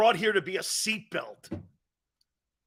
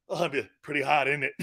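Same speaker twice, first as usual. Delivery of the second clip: normal talking voice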